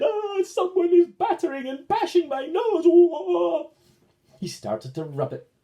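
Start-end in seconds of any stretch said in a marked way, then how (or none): 0.0-3.7 very high, loud distressed cry; pained wail. Acted. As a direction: wail as the nose gets bashed. Red goblin's nose being bashed.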